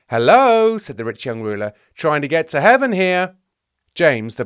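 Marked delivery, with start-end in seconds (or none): 0.1-0.8 high, loud attention-grabbing call; impatient hello. Acted. Young ruler interrupts the disciples' digression, impatient. 1.9-3.4 high, loud exasperated complaint; impatient reminder. Acted. Young ruler reminds the bickering disciples he is waiting, impatient. Ruler demands attention, being ignored.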